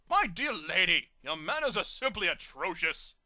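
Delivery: loud, slow haughty disdain; disgusted snob. A narrator's reading. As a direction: scold her manners.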